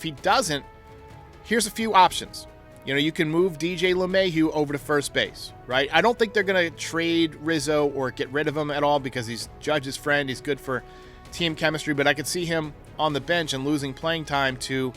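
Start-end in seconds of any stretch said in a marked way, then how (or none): none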